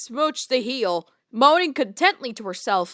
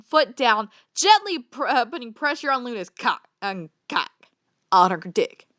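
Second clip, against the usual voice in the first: plain reading voice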